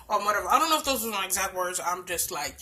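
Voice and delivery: usual speaking voice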